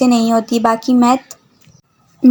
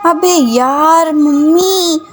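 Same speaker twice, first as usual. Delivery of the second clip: drawn out slow whine, pitched up; whiny groan; 4 semitones higher, much slower than usual. Acted. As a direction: stretch it out like a sulking boy. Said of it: "वो मुंह बनाते हुए".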